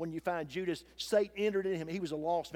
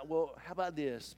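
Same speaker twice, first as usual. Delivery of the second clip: ordinary talk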